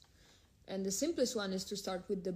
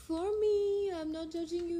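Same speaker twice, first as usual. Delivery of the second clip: playful high voice; 9 semitones higher, 5 dB louder than usual. Real, not acted. Teasing a viewer about marriage.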